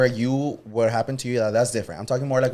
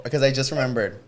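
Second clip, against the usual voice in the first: usual speaking voice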